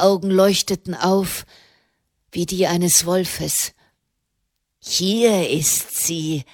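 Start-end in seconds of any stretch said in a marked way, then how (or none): 4.8-6.4 slow, drawn-out, in a different voice; a greasy ruffian crowing over his find; gloating shout. A narrator's reading. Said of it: "schrie er". (langsam und gedehnt, mit fremder Stimme triumphierend)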